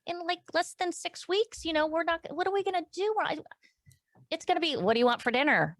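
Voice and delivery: high, wavering reply close to tears; tearful answer. Retold or imitated. To her husband in the kitchen, high and near tears. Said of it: "And I said".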